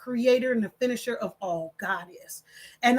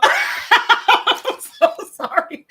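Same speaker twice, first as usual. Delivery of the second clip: high, loud laughter swallowing the words; laughing apology; 9 semitones higher, 6 dB louder, much slower than usual. Real, not acted. Streamer apologizing to viewers for cracking up, laughing. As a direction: apologize helplessly through real laughter.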